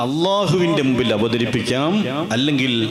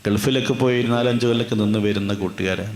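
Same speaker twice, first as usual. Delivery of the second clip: normal talking voice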